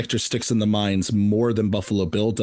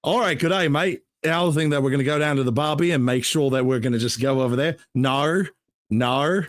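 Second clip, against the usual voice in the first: hammed-up Aussie accent; played-up Australian accent. Shown as a demonstration. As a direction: do a bad, exaggerated Australian accent. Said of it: "show the audience your Australian accent".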